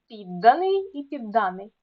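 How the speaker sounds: ПІДданий, then підДАний; contrasting stress. Shown as a demonstration. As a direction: show підданий with first, then second syllable stressed. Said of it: "значення слова залежить від наголосу".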